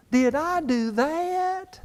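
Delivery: high, slow nasal-free squeak of a nerdy teen, a playful imitation; Steve Urkel impression. Preacher imitating Steve Urkel for the congregation, high and slow. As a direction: drag it out in a high goofy voice. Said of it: "Remember Steve Urkel?".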